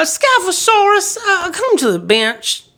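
high, put-on Southern courtroom drawl; Southern accent, hammed-up and high; Southern lawyer. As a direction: summon them in a mock Southern lawyer voice. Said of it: "doing the Southern accent, keeping the lawyer Southern accent".

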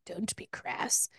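hushed, slow mock reproof; retold low-volume scandalized scolding; mildly scandalized mother. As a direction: say it softly and slowly, a little scandalized. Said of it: "the mom is a little scandalized".

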